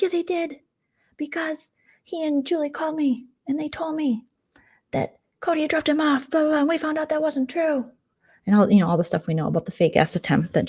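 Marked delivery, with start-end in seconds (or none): 0.0-7.9 high-pitched imitation of a quarreler; mock indignant comeback; mock argumentative. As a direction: insist in a put-on high voice. Acting out Mom arguing back.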